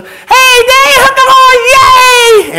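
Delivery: very high, loud, slow cheer; hammed-up squeal of triumph; screaming excited graduate. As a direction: shriek it across the stage to Dad. Acts graduate cheering to Dad.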